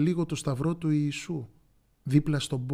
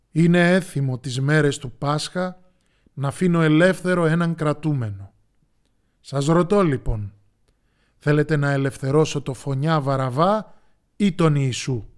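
loud public announcement; proclaiming to the crowd; 9 dB louder than usual. A narrator's reading. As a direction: call out to a whole crowd. Pilate addresses the crowd outside.

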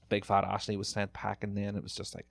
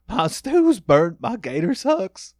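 high, loud, slow mock whine; mock contestant meltdown; 10 semitones higher, 11 dB louder, slower than usual. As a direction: ham up a cook losing it over burnt food.

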